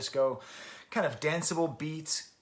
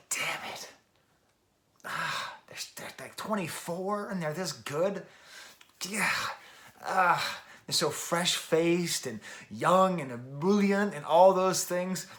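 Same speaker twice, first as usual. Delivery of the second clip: high-pitched groaning exasperation, building louder; exasperated frustration; 6 semitones higher, 5 dB louder, slower than usual. Acted. Middle-aged man marvelling at brilliant young musicians, exasperated frustration. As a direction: act out envious middle-aged frustration, swelling as it goes.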